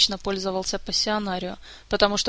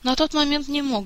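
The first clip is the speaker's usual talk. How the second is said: higher, mildly rueful; sighing admission; 6 semitones higher than usual. A narrator's reading. Prince admits he couldn't fight back, mildly sighing. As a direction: admit it with a slight sigh. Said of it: "вздохнул Мафей".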